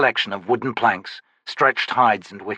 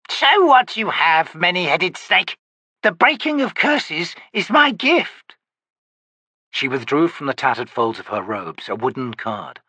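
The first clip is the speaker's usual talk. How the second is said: put-on old witch; 7 semitones higher, 3 dB louder than usual. Witch grumbling at her visitor, high crone voice. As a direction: croak it like a cranky hag.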